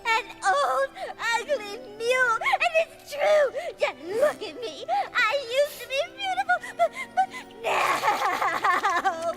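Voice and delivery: high, sobbing self-pity; theatrical weepy lament; tearful wailing. Acted. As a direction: wail it melodramatically through tears. Insulted pony sobbing over her looks.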